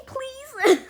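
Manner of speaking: high pleading please; begging please. Real, not acted. Girl begging viewers to play along, high pleading. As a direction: plead sweetly, squeaky and loud.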